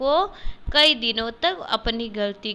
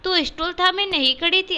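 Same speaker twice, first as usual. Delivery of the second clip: higher, louder accusing question; boy blames his sister, higher and louder; 8 semitones higher, 5 dB louder, faster than usual. Acted. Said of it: "Keshav".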